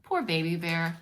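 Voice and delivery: ordinary delivery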